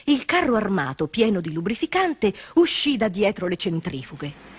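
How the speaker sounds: plain reading voice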